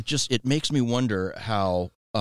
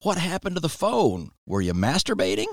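lightly voiced grandma, starting high; imitated grandma; 9 semitones higher than usual. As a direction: imitate a grandma's blunt interrogation. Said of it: "And she goes".

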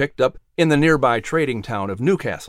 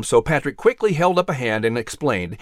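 plain reading voice

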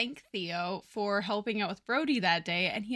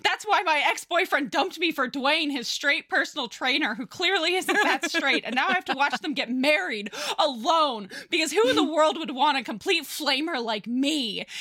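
higher and louder, campy melodramatic wail; dramatic self-pity; 5 semitones higher, 4 dB louder than usual. Acted. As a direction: throw yourself into the self-pity like a diva. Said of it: "he's like".

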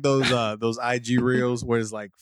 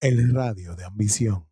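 lower, smooth Spanish announcer; smooth Spanish-language DJ; Spanish radio voice; 4 semitones lower, 4 dB louder than usual. Pretend. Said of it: "you have a smooth voice".

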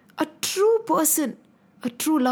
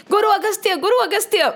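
higher and louder, eager calling; 8 semitones higher, 3 dB louder, faster than usual. Acted. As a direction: call out to the guru as you run in. Disciple calling out to guru.

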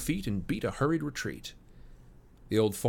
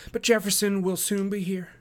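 put-on high-pitched, hopeful young woman; spirited daughter; 10 semitones higher, 3 dB louder than usual. A narrator's reading. Daughter promising her father help is coming, spirited. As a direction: reassure your father with spirit. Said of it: "she answered with spirit".

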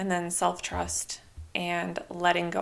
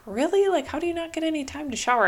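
high, loud and fast, in disbelief; retold disbelief; 8 semitones higher, 4 dB louder, faster than usual. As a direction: scoff at the idea, talking quickly. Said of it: "And I was like".

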